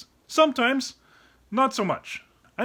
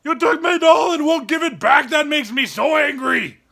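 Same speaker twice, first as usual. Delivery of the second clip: mock angry; 4 semitones higher, 5 dB louder than usual.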